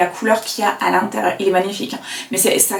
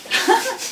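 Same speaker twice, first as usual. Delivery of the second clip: short high-pitched giggle; high laugh; 13 semitones higher, 4 dB quieter than usual. Real, not acted. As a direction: burst into a quick giggle. Laughs at the mix-up. (petit rire aigu)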